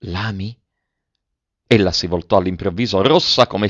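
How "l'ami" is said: L'ami? softly whispered, close to her ear. A narrator's reading. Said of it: "e le sussurrò piano all'orecchio". (L'ami? sussurrato piano all'orecchio)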